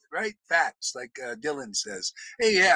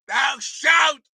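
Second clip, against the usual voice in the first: loud, higher booming decree; shouted commandment; 5 semitones higher, 3 dB louder than usual. Acted. Preacher booming the Bible commandment, shouting. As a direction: thunder the words from on high. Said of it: "Shout that".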